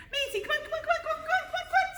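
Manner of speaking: bright high-pitched calling to the dog, laughing; sing-song high puppy call; high happy voice. Real, not acted. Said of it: "High voice".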